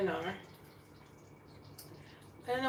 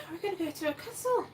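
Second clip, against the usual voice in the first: higher, quick and giddy; excited squeal; 8 semitones higher, faster than usual. Real, not acted. Vlogger gushing to viewers about the castle, giddy. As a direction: bubble over about the castle.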